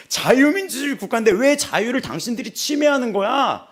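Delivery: loud high rant with 왜 punched; angry rant. Retold or imitated. Woman accusing the passengers, loud angry rant. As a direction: rant it at everyone.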